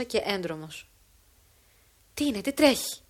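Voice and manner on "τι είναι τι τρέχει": raised, puzzled outcry; father arriving to find the scene, bewildered; utter bewilderment. Acted. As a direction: blurt it out in confusion. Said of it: "έκραξε εν άκρα απορία ο Γιάννης".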